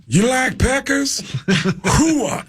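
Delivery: very high, slow, hammed-up impression; swooping movie-star caricature; Al Pacino. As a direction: draw it out like a catchphrase. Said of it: "I'm talking to Al Pacino".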